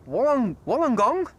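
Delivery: Wollongong tried hesitantly, rising like a question; guessing at an Australian place name; unsure try at Wollongong. Real, not acted. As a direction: sound out Wollongong unsure of the stress. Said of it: "How do you pronounce that?".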